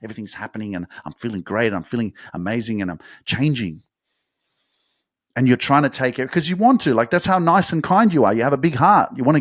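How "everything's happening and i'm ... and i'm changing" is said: much softer, fast, bubbling under the breath; gushing quietly like a secret; hushed excitement. Retold or imitated. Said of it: "We're like".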